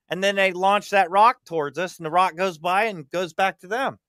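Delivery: usual speaking voice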